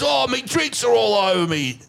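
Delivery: hammed-up indignant drunk passenger; mocking the passenger. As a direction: whine it out like the sozzled bloke behind. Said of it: "He goes".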